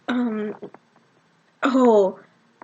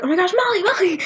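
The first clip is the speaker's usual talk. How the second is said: higher-pitched excited call to a friend; 8 semitones higher than usual. Retold or imitated. Friends spot Molly in the venue, excited calling out. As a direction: act out spotting a friend, voice lifted.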